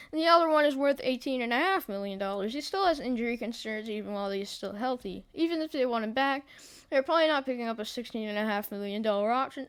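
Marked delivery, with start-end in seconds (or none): none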